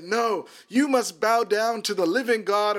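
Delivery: a touch of righteous defiance in a lifted voice; bold retort. Retold or imitated. Bold reply confronting the demon.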